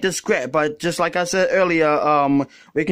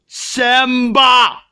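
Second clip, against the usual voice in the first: shouting a name across a distance; 5 semitones higher, 4 dB louder than usual. Acted. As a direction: call out Simba at full voice. Calling Simba louder.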